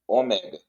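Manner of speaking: "Ômega" as oMÉga, middle syllable open; correct stressed ME. Shown as a demonstration. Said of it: "a forma correta de pronunciar".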